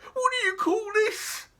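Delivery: high, breathless cabbie; gasping taxi driver. Acted. Taxi driver out of breath from dragging the heavy trunk. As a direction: gasp it out after dragging the trunk.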